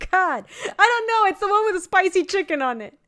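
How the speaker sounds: high, laughing exasperation. Real, not acted.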